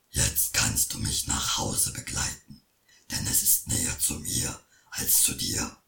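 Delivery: very low, whispery troll. Acted. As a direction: speak like a hushed troll. Troll speaks.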